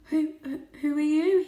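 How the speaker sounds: high, loud, stammering fright; a small cat stuttering at two big cats; frightened stammer. A narrator's reading. Trembling kitten asks the strange cats who they are, frightened. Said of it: "he said".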